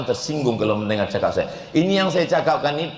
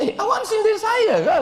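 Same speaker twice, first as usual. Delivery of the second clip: very high, comically affronted challenge; mock offence. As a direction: squeal it as if playfully stung by a jibe. Acts himself confronting the other preacher. (pura-pura tersinggung dengan suara tinggi)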